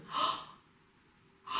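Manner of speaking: sharp, voiceless inward "oh!"; quick open-throated intake of air; pleasantly surprised gasp. Shown as a demonstration. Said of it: "vous avez reçu une surprise agréable".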